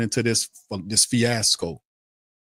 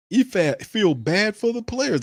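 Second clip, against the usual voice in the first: mocking disbelief; 10 semitones higher than usual. Mocking incredulous rant.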